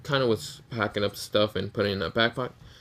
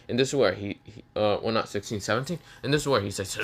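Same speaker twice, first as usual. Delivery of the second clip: normal talking voice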